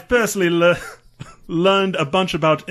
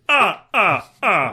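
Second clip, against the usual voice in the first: higher staccato vampire laugh; the Count; 4 semitones higher, slower than usual. Acted. Co-host told to punctuate the review, staccato Count laugh. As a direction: punch out each "ah" as a vampire laugh. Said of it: "punctuate it with ah, ah, ah periodically".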